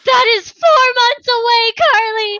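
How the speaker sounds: loud, high-pitched protest; comic, high and loud complaint; mock outraged cry. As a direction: wail it in exaggerated dismay. Mock outrage over a long wait.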